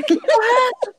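laughing disbelief. Retold or imitated. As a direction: ask it through laughter in a very high voice. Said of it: "Aí ele". (rindo, bem agudo, sem acreditar)

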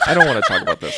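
high, loud laughing whine; laughing protest. Real, not acted. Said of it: "You hate it that much".

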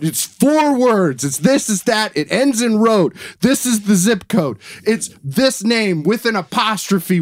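high-pitched, insistent listing; exasperated spelling-out. Retold or imitated. Fed-up customer spelling the new address to an agent, exasperated. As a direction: hammer out each item, pitch up, fed up.

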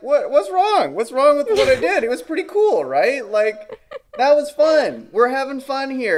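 high, loud, unrepentant protest; mock innocence. Child answering an annoyed parent, mock innocence. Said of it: "And I was like".

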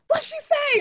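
high-pitched imitation of an alarmed friend; startled demand. Acts out friend's suspicious reaction.

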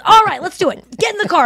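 high, loud excited command; rally her into the car; hyped Donnell. Retold or imitated. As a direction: Donnell pushing Nikki into the car. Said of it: "he goes".

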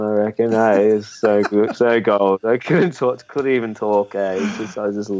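usual speaking voice

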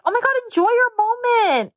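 put-on high gushing girly voice; imitating girls. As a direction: squeal it out like a giddy contestant. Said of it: "The girls are like".

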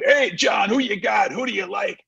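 eager fan calling out; pestering fans. Retold or imitated. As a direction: call out to John like a pushy fan. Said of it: "And people".

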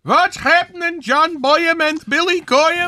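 put-on high, slow old rabbi greeting. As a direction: play a jovial old rabbi. Comedic old rabbi character.

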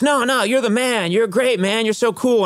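high, insistent mock gushing; starstruck fan. Delivery man praising a celebrity customer, mock starstruck. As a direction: pile on praise like an overexcited admirer.

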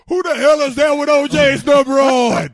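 outraged fan shouting from the stands; retold fan yell. Fans yelling at new number.

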